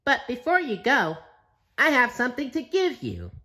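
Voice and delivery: high, bright squeaky cartoon sponge voice; cheerful SpongeBob. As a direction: chirp it like a happy SpongeBob.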